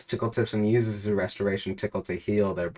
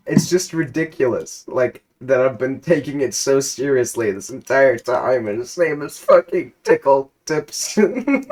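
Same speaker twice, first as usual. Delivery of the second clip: amused laughing; 5 semitones higher, 7 dB louder than usual. Real, not acted. Still laughing at the name.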